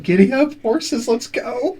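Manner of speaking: cheery cart driver. Retold or imitated. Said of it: "Tyrell's like".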